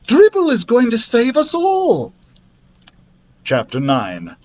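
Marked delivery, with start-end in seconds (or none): 0.1-2.1 high-pitched, thrilled announcement; elated character voice; excited shout. A narrator's reading. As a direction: proclaim it like an excited princess. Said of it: "she shouted excitedly".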